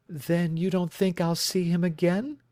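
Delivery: a worried bride telling her story; anxious young woman. Acted. Client asks.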